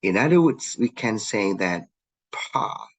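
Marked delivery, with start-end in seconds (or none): 2.3-2.9 "pa" voiceless, all breath after the p; aspirated p. Shown as a demonstration. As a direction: puff out "pa" with a strong airy release. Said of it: "aspirated pa".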